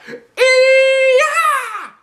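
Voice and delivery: very loud, very high scream; full-blast kiai. Acted. Class finally screaming as loud as they can. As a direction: scream your head off. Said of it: "We all screamed our heads off".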